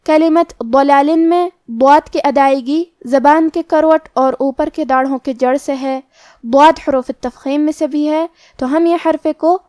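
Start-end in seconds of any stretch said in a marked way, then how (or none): none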